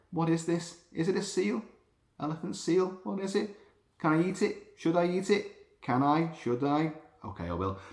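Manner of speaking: high, wondering shark voice, asking itself; curious shark. Pretend. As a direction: play the shark sizing up its prey, then deciding. Voicing the shark's thoughts.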